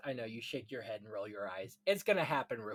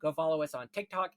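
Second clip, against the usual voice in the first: usual speaking voice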